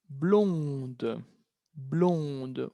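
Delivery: "blonde" with the final D clearly sounded; soft model of "blonde", final D heard; pronounced D ending. Shown as a demonstration. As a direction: say "blonde" slowly, D at the end. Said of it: "una D al final".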